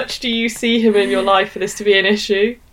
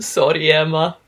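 put-on Scottish accent; 5 semitones lower than usual. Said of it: "attempting the accent".

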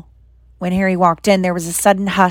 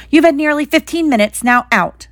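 higher-pitched, firm dismissal; 7 semitones higher than usual. A narrator's reading. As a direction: order a visitor out firmly. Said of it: "she said firmly".

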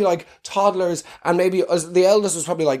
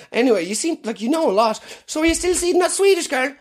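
high-pitched gossip; nosy local voice; 10 semitones higher than usual. Pretend. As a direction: gossip like a village busybody.

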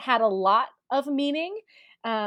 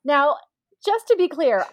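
ordinary talk

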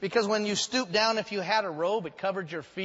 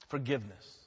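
ordinary delivery

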